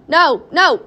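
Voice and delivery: high, loud refusal; urgent protest. A narrator's reading. Wonka stops the queasy mother, urgent protest. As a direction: fend it off urgently, loudly.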